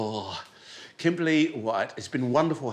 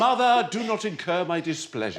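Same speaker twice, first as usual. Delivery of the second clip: higher, grand mock scolding; mock-imperious child; 5 semitones higher than usual. Host playing British child scolding mother, mock-imperious. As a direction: scold mother with pompous, amused authority.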